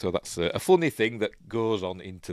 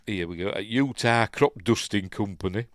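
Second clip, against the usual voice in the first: ordinary talk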